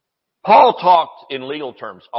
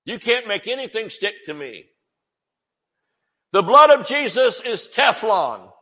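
defiant declaration; 6 semitones higher than usual. Pretend. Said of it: "we need to say".

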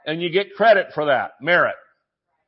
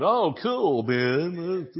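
stoned dude voice, softer at the end; stoner; 4 dB quieter, much slower than usual. Acted. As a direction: play a dazed stoner reacting. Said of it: "Well, then they got stoned".

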